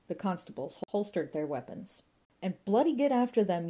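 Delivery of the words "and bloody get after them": higher and louder furious command; angry order. A narrator's reading. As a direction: bark it at the constables. Inspector orders pursuit.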